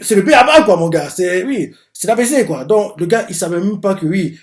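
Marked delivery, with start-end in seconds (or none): 0.0-2.6 high, loud disbelieving scoff; exasperated outburst. Real, not acted. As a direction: spell out how basic it is, loud and high. Exasperated about Eki's blunder.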